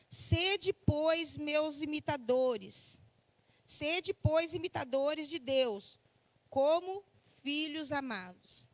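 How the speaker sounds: loud, slow proclamation of scripture; declaimed Bible verse, drawn-out words; scripture reading. Acted. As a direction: read the verse out slowly, projected to the congregation.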